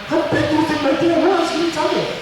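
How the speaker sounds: high-pitched indignant disbelief; mock incredulous. Son objecting to friend's remark about shovel, incredulous. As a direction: protest as if the idea were absurd.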